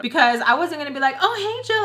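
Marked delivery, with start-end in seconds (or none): 1.2-1.8 high, loud fangirl squeal of a greeting; mock fangirling. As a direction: gush like an overexcited fan, pitched way up. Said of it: "like, fangirling over him".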